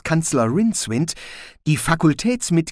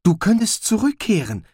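Zweiblum, helpful; 9 semitones higher than usual. A narrator's reading. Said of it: "sagte Zweiblum".

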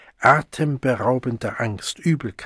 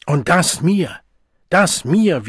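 much higher, louder indignant sputter; indignant outrage; 6 semitones higher, 5 dB louder, slower than usual. A narrator's reading. The offended Arch-Community-Songster repeating himself, high and indignant. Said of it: "wiederholte der Erzchormeister immer wieder".

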